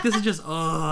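laughing through the words; half laughing, half exasperated. Retold or imitated. Retold frustration, laughing.

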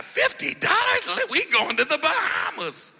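high, thrilled squeal; excited. Retold or imitated. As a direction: get giddy about the bargain. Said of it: "you're getting excited".

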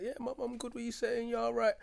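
high, breezy carefree reply; cheerful. Retold or imitated. Answering his mum on the phone, high and breezy. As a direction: sound light and joking.

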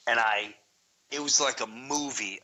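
usual speaking voice

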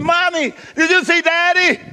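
put-on high, loud, eager child's question; daughter asking. Daughter asking her mother during hide and seek, high and loud. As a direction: ask it as an excited little girl. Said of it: "She said".

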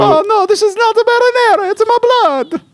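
high, hammed-up Italian cartoon voice. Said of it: "the most stereotypical Italian".

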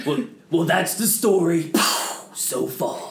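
laughing through the catchphrase; laughing narrator line. Shown as a demonstration. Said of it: "I know you like doing that one".

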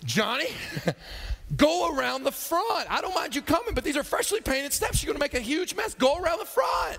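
high-pitched scolding call; grandma yelling. Acted. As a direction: call the kid off, insisting. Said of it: "she yells at him".

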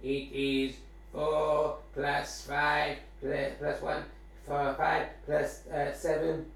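slow, flat machine voice; robot voice. Pretend. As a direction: count it out like a robot. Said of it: "I let the robot voice do it".

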